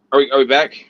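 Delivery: usual speaking voice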